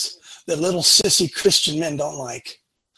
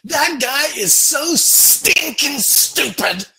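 mock insult; 7 semitones higher, 4 dB louder, much slower than usual. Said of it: "if I said to you".

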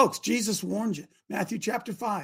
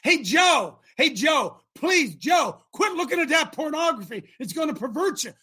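raised, insistent appeal; urgent pleading; 6 semitones higher, 6 dB louder than usual. Acted. Preacher begging Joe to stop a habit, urgent. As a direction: plead with a friend to quit.

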